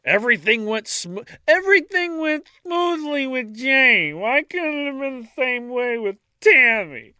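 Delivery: high, loud hammed-up tantrum; over-the-top imitation of an angry outburst; angry exclamation. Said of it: "angrily, exclaiming, quote".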